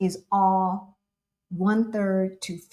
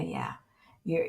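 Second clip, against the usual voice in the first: usual speaking voice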